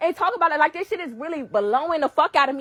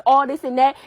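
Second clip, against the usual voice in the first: usual speaking voice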